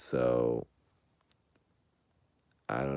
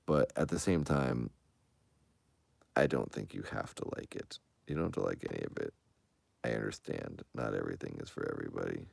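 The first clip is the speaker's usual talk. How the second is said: soft spoken; 7 semitones lower, 7 dB quieter than usual. Real, not acted. Held soft voice.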